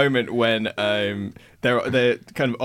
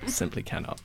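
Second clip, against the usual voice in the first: whispered; 8 semitones higher, 12 dB quieter than usual. Retold or imitated. Quoting Reynolds.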